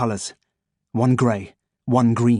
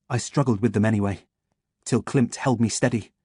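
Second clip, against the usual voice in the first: ordinary delivery, nothing marked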